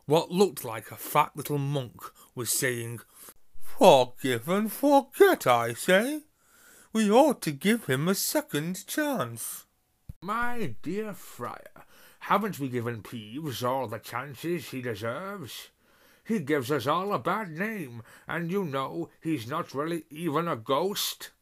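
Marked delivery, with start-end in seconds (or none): 3.6-9.6 high, loud, slow jolly monk; a big-hearted friar booming kindly. Acted. Fat monk ghost speaking. 10.2-21.3 a prim gentleman ghost objecting; haughty ghost voice. Acted. Ruffed ghost arguing with friar.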